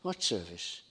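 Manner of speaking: muttered wondering repeat; puzzled echo. Retold or imitated. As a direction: echo her puzzlement under the breath. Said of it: "repeats the quote himself".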